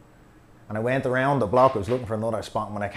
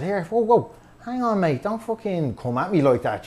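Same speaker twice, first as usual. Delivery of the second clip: indignant raised voice; 8 semitones higher than usual. Retold or imitated. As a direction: push back hard, voice jumping up. Confronting aggressive truck driver.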